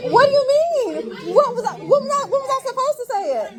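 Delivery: shrill-pitched, loud, half-laughing protest; high loud protest. Real, not acted. Teacher answering the class's outcry, loud high protest. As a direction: protest loudly over the uproar, laugh in the voice.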